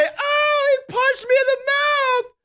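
whiny high mock victim; mock them as a sniveling victim; mock crybaby. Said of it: "start crying and say".

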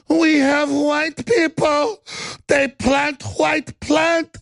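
high, slow hammed-up fake Latin accent; mock fake accent. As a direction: parody her broken fake accent, drawn out. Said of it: "She goes".

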